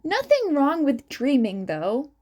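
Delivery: ordinary delivery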